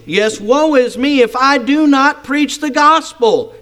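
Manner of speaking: deliberate, emphatic scripture reading. Acted. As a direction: stretch out WOE IS ME with rising intensity. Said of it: "Notice the emphatic again".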